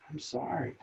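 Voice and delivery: a soft, humble apology; just let it out gently and small; soft sincere apology. Shown as a demonstration. To someone yelling at you, a soft sincere apology. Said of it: "and then you just go".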